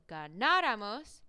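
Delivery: ganáramos with a loud, stretched NÁ before ramos; slow ganáramos, accent hard on the second syllable; stress on NÁ. Shown as a demonstration. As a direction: punch the NÁ in ganáramos. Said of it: "accent right before the ramos".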